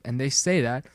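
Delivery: ordinary delivery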